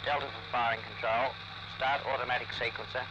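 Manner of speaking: tinny radio transmission, low and distant; crackly military radio. Real, not acted. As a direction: speak through a crackly field radio. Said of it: "Loudspeakers sounding harshly".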